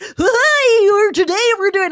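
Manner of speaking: put-on shrieking kids-show host squeal; Blippi impression. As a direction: ham up a manic children's host. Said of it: "Can you imagine".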